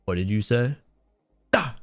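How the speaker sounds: ordinary talk, nothing marked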